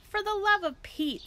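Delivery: exasperated Flora. A narrator's reading. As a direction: throw up your hands in disbelief.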